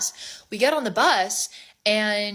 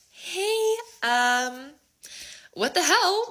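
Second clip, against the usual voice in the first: very high, put-on politeness; hesitant high-pitched opener, retold; awkward confrontation; 11 semitones higher, much slower than usual. Girl messaging the other woman on Snapchat, awkward confrontation.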